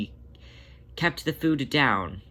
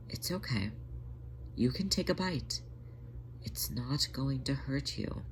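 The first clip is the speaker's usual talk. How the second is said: gentle coaxing; 3 semitones higher, 9 dB quieter than usual. A narrator's reading. Jenny coaxing sick tom to eat.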